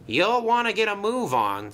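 high-pitched brisk urging; station master. Acted. Station master telling a resting engine to move, urging. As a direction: hurry him along in a lifted voice.

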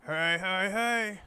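hammed-up Fat Albert hey hey hey; Fat Albert impression. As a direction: belt the catchphrase in a bad cartoon voice. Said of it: "It's my Fat Albert impression".

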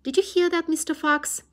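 loud high yell down a hole. Acted. Bean shouts down the fox's hole, loud high yell. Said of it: "yelled Bean".

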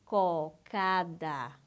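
"Cocada" in slow, separated syllables, low; very slow reading. Shown as a demonstration. Teacher checking how you read the word, very slow reading. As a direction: stretch each syllable of "Cocada". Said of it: "a palavra cocada assim?".

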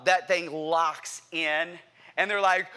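ordinary delivery

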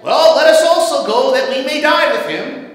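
lightly acted sarcasm from a pessimist; almost sarcastic. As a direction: throw it out with exaggerated resignation. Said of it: "almost sarcastically said".

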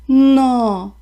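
loud giddy-up; a loud command to the horse; milkman's shout. Acted. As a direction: call out to the horse. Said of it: "крикнув молочник коню".

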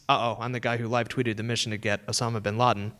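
usual speaking voice

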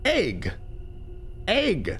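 "egg" said like "ayg", falling; "egg" rhyming with vague; own pronunciation. Shown as a demonstration. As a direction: say "egg" firmly with a long ay. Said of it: "Not egg".

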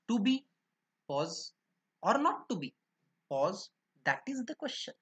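"to be", pause, "or not to be", pause; pauses marked. Shown as a demonstration. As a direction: break the line with pauses. (थांबे देऊन पठण)

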